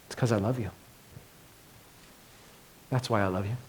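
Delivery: soft, low, loving reply; a quiet, tender voicing of Jesus; Jesus, tenderly. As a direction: answer like you'd hold them close. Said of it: "He says".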